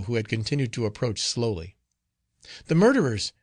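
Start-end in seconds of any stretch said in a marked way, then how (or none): 2.7-3.3 high, loud cry of terror; terrified cry. Acted. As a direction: cry out in sudden panic. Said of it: "she cried".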